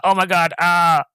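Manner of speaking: mock shock. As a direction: act out the victim's horrified realisation. Voices speared man's stunned look.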